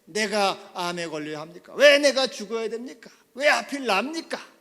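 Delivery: loud, high, indignant complaint; acted anger. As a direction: rail against fate as the stricken patient. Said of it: "화를 냅니다".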